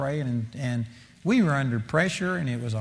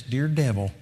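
timid dear devil; 4 semitones lower than usual. Retold or imitated. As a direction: imitate her shy polite prayer with a laugh in it. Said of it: "this woman started off".

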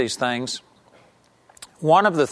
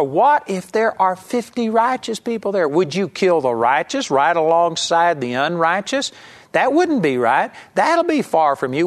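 indignant, higher-pitched challenge to God; scolding God; 3 semitones higher than usual. Retold or imitated. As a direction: take God to task, mock-scolding. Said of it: "he's scolding God".

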